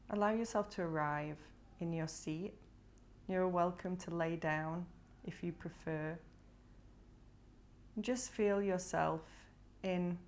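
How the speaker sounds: unhurried meditation guide with long pauses between phrases; guided meditation. Acted. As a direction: gently guide them to breathe and ground down, leaving space after each phrase. Leads a body scan meditation.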